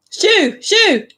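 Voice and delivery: high, sharp shooing shout; real shout. Real, not acted. Shooing a cat off the balcony, high-pitched shout. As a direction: shout to chase an animal away, high and loud. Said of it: "Sorry, I shouted".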